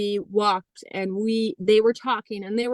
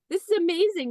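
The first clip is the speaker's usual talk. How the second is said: delighted; 9 semitones higher than usual. Retold or imitated. Delighted self-talk on plane.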